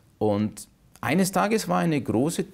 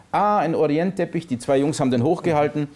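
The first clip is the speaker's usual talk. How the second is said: normal talking voice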